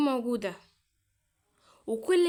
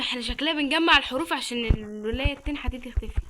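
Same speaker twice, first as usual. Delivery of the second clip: normal talking voice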